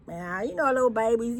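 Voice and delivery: ordinary talk, nothing marked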